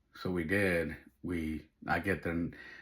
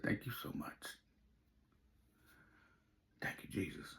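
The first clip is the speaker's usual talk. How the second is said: hushed, unvoiced prayer; whispered thanks; 14 dB quieter than usual. Dad alone in his car thanking Jesus, whispered. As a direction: whisper it, drained by gratitude.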